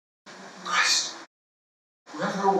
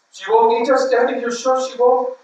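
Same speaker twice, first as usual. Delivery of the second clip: put-on higher, louder worried child's voice; anxious child; 6 semitones higher, 4 dB louder than usual. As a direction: plead with Daddy for reassurance. Child anxiously asks father.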